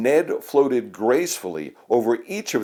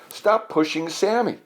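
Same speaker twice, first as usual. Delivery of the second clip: crying out; 8 semitones higher than usual. Acted. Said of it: "Ned cried".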